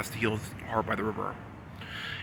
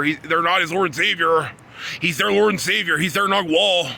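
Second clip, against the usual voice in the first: much higher and louder, raging; ranting at Michael, voice raised and furious; 5 semitones higher, 6 dB louder than usual. Real, not acted. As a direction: blast it out at full force. Ranting about his Lord and Savior.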